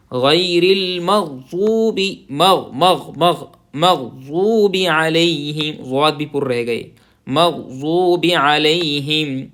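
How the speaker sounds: مغضوب with a full throat غ and heavy ض; full ghain. Shown as a demonstration. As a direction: gargle the غ fully. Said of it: "غین کی آواز بھی پورا آئے گی". (غین اور ضاد پوری آواز سے)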